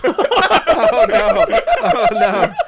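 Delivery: high, loud laughing groan; laughing in disgust. Real, not acted. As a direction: laugh through the horror of it. Reacting to the foul fish taste.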